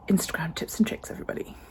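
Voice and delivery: whispering. Real, not acted. Unvoiced playful aside.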